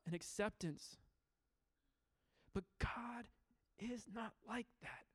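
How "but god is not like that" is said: hushed whisper. Real, not acted. Preacher hushing the congregation about God. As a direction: whisper it, voice falling away.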